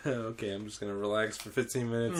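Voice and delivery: low, soft and quick aside to himself; relieved self-talk. Retold or imitated. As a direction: murmur it low, settling down to relax.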